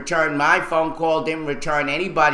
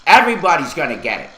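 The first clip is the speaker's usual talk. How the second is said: ordinary talk, nothing marked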